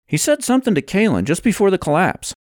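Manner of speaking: high, quick character voice; Levi, recalling urgently. Acted. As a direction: report it fast and high-pitched.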